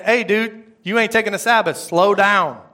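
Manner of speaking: God as a loud, casual buddy; God talking bluntly. Retold or imitated. God telling the preacher to take the Sabbath, blunt and loud. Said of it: "sometimes he talks to me like that".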